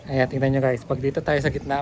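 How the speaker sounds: ordinary talk, nothing marked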